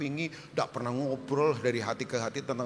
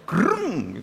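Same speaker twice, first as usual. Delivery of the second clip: buzzing "grrng" of a current; imitated electric zap; 5 semitones higher, 6 dB louder than usual. Joking about couples holding hands, imitated electric buzz. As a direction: make the sound of an electric jolt.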